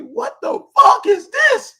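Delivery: high, slow, stunned disbelief; shocked disbelief. Retold or imitated. Reacting to a woman's TikTok page, stunned. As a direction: recoil at the sight, slowly.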